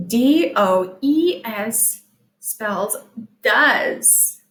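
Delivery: letters "D O E S" slow; slow letter spelling; spelling out slowly. Shown as a demonstration. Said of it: "spelling letters".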